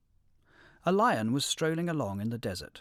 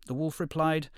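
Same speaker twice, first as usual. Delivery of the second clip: ordinary delivery, nothing marked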